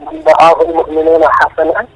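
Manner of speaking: ordinary talk, nothing marked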